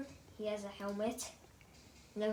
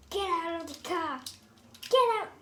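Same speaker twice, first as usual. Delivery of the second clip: toy figure order; 6 semitones higher, 6 dB louder than usual. Pretend.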